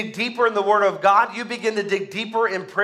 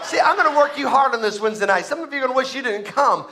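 ordinary talk, nothing marked

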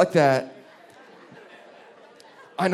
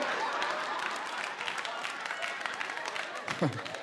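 breathless laugh; 13 dB quieter than usual. Real, not acted. Laughs after mom's line.

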